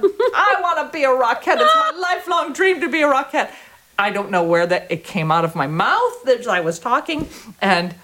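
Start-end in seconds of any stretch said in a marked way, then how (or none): 0.0-3.5 high, laughing and dreamy; laughing childish wish. Retold or imitated. Little girl telling her mother her dream, high and laughing.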